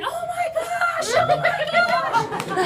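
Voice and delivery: high shrieking oh my gosh through laughter; screaming. Retold or imitated. Little sister screaming as Morgan fakes a broken leg, shrieking. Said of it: "She was screaming".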